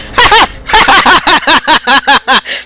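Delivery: loud startled laugh; shocked laughter. Real, not acted. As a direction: laugh off the fright. Laugh after scream.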